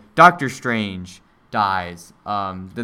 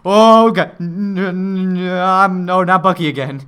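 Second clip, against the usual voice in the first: loud, high, stammering despair; mock anguished Captain America; 6 semitones higher, 7 dB louder, slower than usual. Captain America losing Bucky again, loud stammering wail. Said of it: "Captain America's like".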